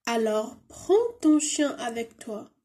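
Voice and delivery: slow mother's line; slow French read-aloud; slow model reading. Shown as a demonstration. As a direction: read the line slowly for the class. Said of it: "يعني tu sors".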